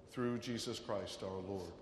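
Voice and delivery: ordinary talk, nothing marked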